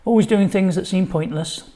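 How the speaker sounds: ordinary delivery, nothing marked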